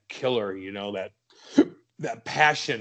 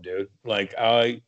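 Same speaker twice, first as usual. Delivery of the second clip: normal talking voice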